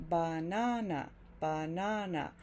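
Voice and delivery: "banana" with equal weight on all three syllables; equal stress. Shown as a demonstration. Teacher showing banana as other languages say it, equal stress. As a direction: give every syllable of "banana" a full "ah" vowel. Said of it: "In many languages, banana is pronounced".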